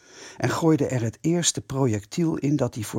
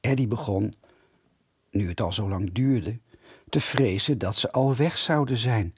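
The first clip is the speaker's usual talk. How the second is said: ordinary delivery, nothing marked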